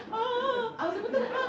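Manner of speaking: put-on child outraged her plan backfired, high squeal; shocked high disbelief.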